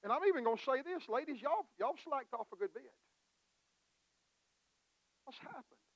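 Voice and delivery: fast, high, fiery exhortation; preaching at full force. Acted. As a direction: rouse the church hard and quick.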